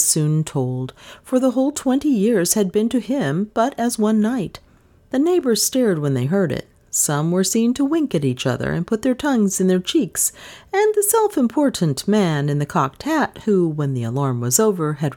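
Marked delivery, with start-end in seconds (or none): none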